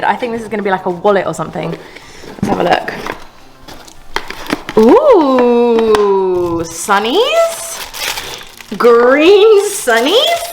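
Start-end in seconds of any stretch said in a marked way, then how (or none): 4.8-10.4 puzzled surprise. Real, not acted. Unwrapping odd green sunglasses.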